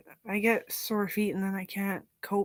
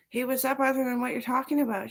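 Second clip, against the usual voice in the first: louder, higher mock interruption; mock call; 6 semitones higher, 9 dB louder than usual. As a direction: cut in on the chattering kid. Calling to her chattering kid.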